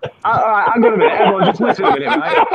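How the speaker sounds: high-pitched, amused plea to listen; laughing call for attention. Real, not acted. Host trying to get the giggling group to listen, amused.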